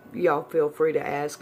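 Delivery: usual speaking voice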